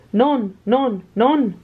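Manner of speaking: mild mock refusal; shouted. The servers pushing back the alpacas, mild shout. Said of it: "shouted the servers".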